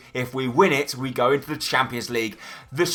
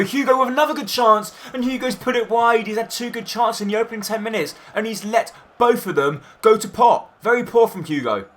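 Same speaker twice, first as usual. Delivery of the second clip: higher pitch, worked-up call of the miss; exasperated commentary; 6 semitones higher than usual. Acted. Commentator reacting to the striker's second miss, worked up. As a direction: groan over the wasted chance.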